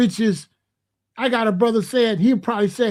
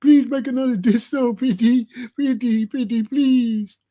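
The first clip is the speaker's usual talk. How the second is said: mock begging; 3 semitones higher than usual. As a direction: beg like a pathetic fan, hammed-up. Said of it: "He need to stop begging fifty".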